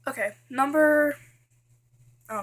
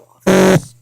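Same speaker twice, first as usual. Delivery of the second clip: much louder, exasperated burst; sudden loud outburst; 4 semitones lower, 18 dB louder than usual. Real, not acted. Vlogger ranting to viewers about show-offs, loud exasperated outburst. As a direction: blurt it out loud in frustration.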